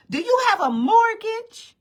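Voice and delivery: high, loud scolding imitation; hammed-up scolding star; mock stern diva. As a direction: grill her like a stern auntie. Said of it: "she turns around and she goes".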